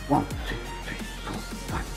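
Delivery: breathy whispered count while punching. Shown as a demonstration. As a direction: count under your breath between punches. Counting reps under breath.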